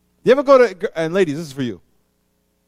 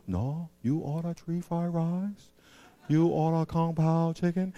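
softer, slow smoothing-over reply; 6 dB quieter, slower than usual. Retold or imitated. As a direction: waiter calmly soothing an angry customer. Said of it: "They get all soft and smooth on you".

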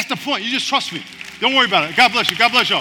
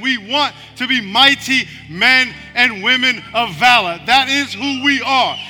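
raised, steady praying voice; fervent prayer; 3 semitones higher, 6 dB louder than usual. Acted. Pastor praying aloud over the congregation, fervent. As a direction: pray over them with conviction.

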